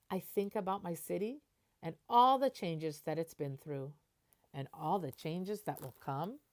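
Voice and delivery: gentle storybook reading voice; picture book narration. Acted. Teacher reading a picture book to the class, storybook voice. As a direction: read it like a bedtime story.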